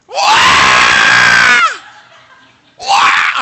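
loud, hammed-up crying wail; mock panicked wail. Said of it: "And she's going".

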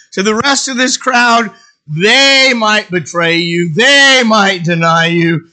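loud, slow emphasis on each accusation; boastful protest. Acted. Peter insisting to the Lord that others might fail, loud boastful protest. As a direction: protest loudly, stretching the words out.

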